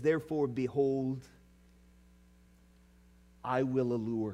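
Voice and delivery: soft, tender reading of God's promise; hushed scripture reading. Acted. Preacher softly reading God's tender words to his bride. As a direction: read it gently, like a whisper of love.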